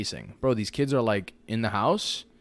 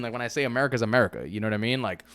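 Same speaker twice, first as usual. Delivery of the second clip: normal talking voice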